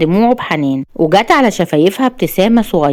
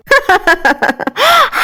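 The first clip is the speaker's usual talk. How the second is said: high manic cackle; shrill-pitched mad villainess laughter; crazed laugh. A narrator's reading. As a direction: laugh like you have lost your mind. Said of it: "وقعدت تضحك بجنون".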